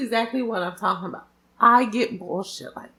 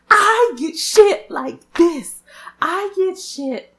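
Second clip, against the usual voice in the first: whiny lament; 8 semitones higher, 6 dB louder, much slower than usual. Real, not acted. Lamenting bad luck.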